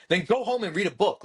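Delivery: high, exasperated retort; heated comeback, retold without full volume; retold angry yell. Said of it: "actually yelled at".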